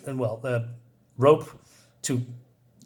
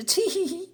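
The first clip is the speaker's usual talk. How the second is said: much higher, soft tittering giggle; 5 dB quieter than usual. Retold or imitated. Rula giggling at Fifa, mock high titter. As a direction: titter like a coy harem girl. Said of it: "And she says".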